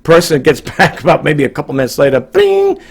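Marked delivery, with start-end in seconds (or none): 2.2-2.8 high sung bing like a message chime; imitated alert. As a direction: mimic the phone notification.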